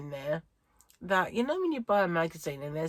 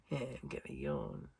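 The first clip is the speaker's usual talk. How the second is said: much lower, softer droning reply; lazy body voice; 9 dB quieter than usual. Retold or imitated. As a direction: give the body a low lazy voice. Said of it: "my body's going".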